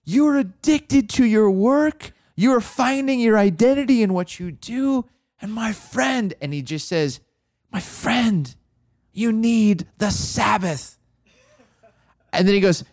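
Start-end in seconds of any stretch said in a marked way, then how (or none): 0.0-6.3 mentor's blunt rebuke. Retold or imitated. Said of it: "kinda New York ways". 7.7-10.9 higher, slow, weighty pronouncement; mentor's earnest verdict. Retold or imitated. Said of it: "kinda New York ways".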